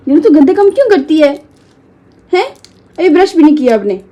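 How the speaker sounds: playful mummy reprimand; mock scolding. Real, not acted. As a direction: scold the little one for being naughty. Scolding child for scratching.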